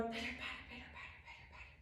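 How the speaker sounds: very soft, rhythmic patter fading away; whispered raindrops. Pretend. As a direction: whisper it like light rain. Imitates falling rain.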